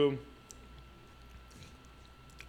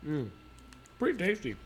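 chewing while talking; 4 semitones higher, 4 dB quieter than usual. Real, not acted. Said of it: "I'm munching really hard over here".

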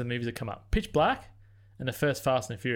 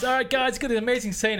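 higher, louder mock director hyping the crew; enthusiastic director; 9 semitones higher, 4 dB louder than usual. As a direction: pitch it up like an overeager director. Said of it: "then the director comes".

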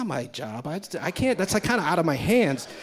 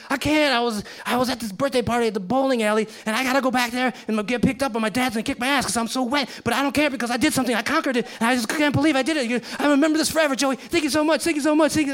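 high, fast gushing thanks; excited kid; 7 semitones higher, faster than usual. Retold or imitated. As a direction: rush it out like an overjoyed kid.

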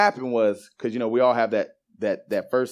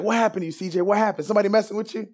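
much higher-pitched, urgent questioning; imitated alarmed boss; 10 semitones higher, faster than usual. Boss finding a crying worker, imitated high-pitched alarm.

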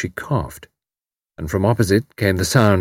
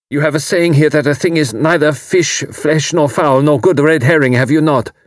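put-on tense, emotional young man; a refugee barely holding together; anguished young German; 7 semitones higher, 4 dB louder than usual. A narrator's reading. Voices Karl.

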